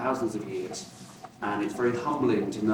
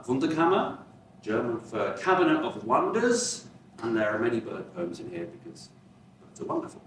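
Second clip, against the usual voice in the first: ordinary delivery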